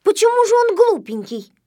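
objecting. A narrator's reading. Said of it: "возразил доктор Пилюлькин". (возражая, громко и высоко)

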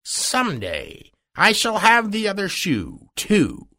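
high-pitched, menacing witch; wicked witch. A narrator's reading. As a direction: threaten her in a high witch voice. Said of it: "said the witch".

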